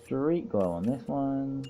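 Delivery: drawn-out condescending lilt; patronizing. Acted. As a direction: stretch it out as if to a small child. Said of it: "my most patronizing voice".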